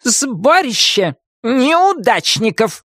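high, drawn-out witch's scorn; contemptuous witch. A narrator's reading. Witch dismisses the bunch of losers, contemptuously. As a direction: fling it slowly in high-pitched contempt. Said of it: "презрительно бросила".

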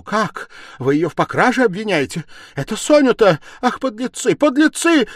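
high, loud and climbing fury; outraged cry. A narrator's reading. Stepmother defending Sonya from a theft charge, outraged shouting. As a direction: scream your indignation at the accusers.

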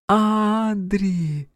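put-on high child's call from afar; a little sister calling his name; Joanna calling. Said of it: "Голос Жоанны".

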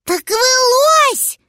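put-on very high, slow, loud childlike cry; excited exclamation. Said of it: "воскликнул Гусля".

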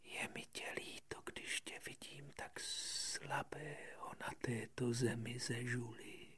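hushed, slow snake whisper. Acted. Had mluví šeptem. (šeptající had)

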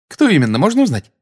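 put-on high-pitched, eager young witch; interested question. A narrator's reading. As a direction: ask with keen curiosity, pitched right up. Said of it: "заинтересованно спросила его женщина".

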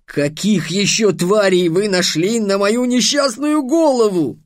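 high, drawn-out wail of exasperation; long, climbing complaint; groaning lament. A narrator's reading. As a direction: bemoan your miserable fate slowly. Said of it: "простонал Щавель".

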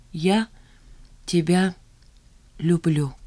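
deliberate, isolated words. A narrator's reading. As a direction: separate words with long pauses. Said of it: "раздельно произнесла Жанна".

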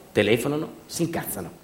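ordinary talk

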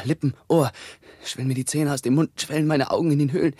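feverish, frightened outpouring in short bursts; mounting panic. Acted. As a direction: plead as the body swells, pitch climbing. Fevered plague victim feels body swelling.

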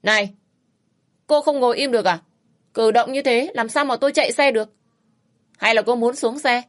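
irritated shouting, fed up with her fidgeting; yelling rider. Acted. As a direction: snap at her like an annoyed biker. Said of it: "Anh ta quát".

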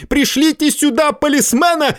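high urgent call; shouting. Acted. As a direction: yell for the police. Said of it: "крикнул Варбек".

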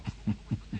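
soft breathy chuckle; the trap is shut, enjoy it; sly little laugh; quiet triumphant laugh. Acted. Doctor triumphs, trap has closed.

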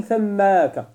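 "ثماك" drawn out, long aa before a final kaf; forbidden suffixed form. Shown as a demonstration. Teacher warns students against adding kaf, "ثماك" drawn out. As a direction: stretch "ثماك" slowly to show the added kaf. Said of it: "فلا تقل".

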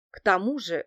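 usual speaking voice

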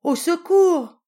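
high, loud, drawn-out cry for help; two little pigs fleeing the wolf and crying for help. Acted. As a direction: scream for help in a panic. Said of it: "crièrent les deux petits cochons".

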